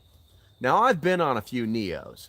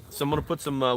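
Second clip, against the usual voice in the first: ordinary delivery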